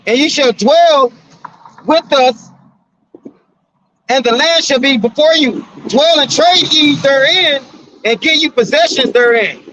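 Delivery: preacher reading King James aloud; scripture reading. Acted. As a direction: lift the voice and lay out the verse slowly.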